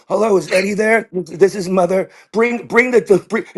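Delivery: high put-on mother voice on the phone; friend's mother. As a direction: impersonate your friend's mom on a call. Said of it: "as his mother, going".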